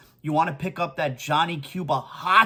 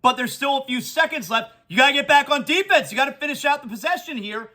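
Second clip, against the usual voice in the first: raised, excited live game call; play-by-play call; 6 semitones higher than usual. Acted. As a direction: call the final seconds like a broadcaster.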